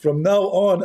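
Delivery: normal talking voice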